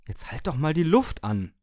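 laut und höher, gespielt aufbrausend; gemäßigtes Brüllen. A narrator's reading. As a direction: energisch dazwischenfahren, ohne voll zu schreien. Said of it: "brüllte ich". (leicht gebremstes Brüllen)

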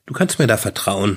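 ordinary delivery, nothing marked

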